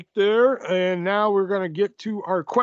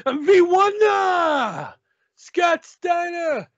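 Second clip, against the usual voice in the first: put-on high shouted promo in an odd accent; Matt Hardy; 9 semitones higher, 4 dB louder than usual. Wrestler challenging an opponent to a math exam, high accented shouting. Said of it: "he has an accent for some reason".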